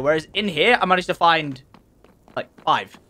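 ordinary talk, nothing marked

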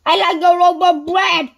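high, very loud boom. A narrator's reading. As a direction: boom out the order at full volume. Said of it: "he boomed".

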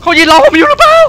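high, loud panicked question; frightened cry. Real, not acted. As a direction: yelp it in real fear. Frightened by figure in game. (ถามเสียงสูงด้วยความกลัว)

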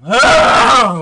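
sudden loud yelp of fright; startled yelp. Real, not acted. Vlogger startled by a spider, loud yelp. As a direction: jump and yelp in real shock.

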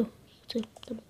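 hushed whisper. Real, not acted. Gamer whispering to himself in the dark. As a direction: breathe the question in a hush.

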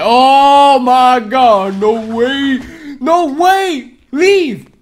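very high, loud panicked yell; shocked scream. Real, not acted. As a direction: scream in sudden alarm. Sudden enemy appears.

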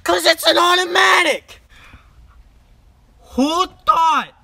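high, loud mock-outraged Jersey rant; exasperated shouting in a Jersey accent; angry Jersey mobster. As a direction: yell it like an angry Italian-American mobster dad. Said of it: "my terrible Jersey Italian mobster, angry Wolf of Wall Street dad accent".

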